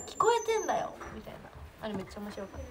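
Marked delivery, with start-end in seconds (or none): 0.1-0.9 mock retort pitched much higher; imitating a retort. Retelling how Reina snapped back on the relay stream, mock retort. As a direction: replay the friend's snappy retort, half-joking. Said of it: "なんか…みたいな".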